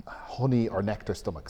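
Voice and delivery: ordinary talk, nothing marked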